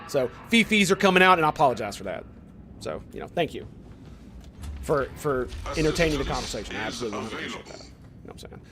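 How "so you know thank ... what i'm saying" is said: soft apology. Real, not acted. Apologizing after heated rant.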